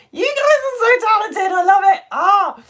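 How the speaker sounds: high, loud gushing praise; excited shout. Real, not acted. Host cheering viewers' talent, high excited shout. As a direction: cheer them on. Enthusing to chat.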